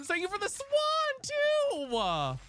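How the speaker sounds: very high, drawn-out thank you; excited thanks. Real, not acted. Streamer thanking a viewer for a swan, excited thanks. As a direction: gush it high and stretched out.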